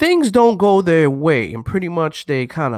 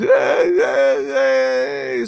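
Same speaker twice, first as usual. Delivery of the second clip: imitating a sobbing man begging his girlfriend; mock crying wail; 9 semitones higher, slower than usual. Mocking crying dudes, high wailing mock cry.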